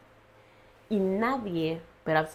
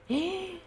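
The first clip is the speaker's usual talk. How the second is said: sharp gasp; 12 semitones higher, 5 dB quieter than usual. Real, not acted. Reliving being hit on head.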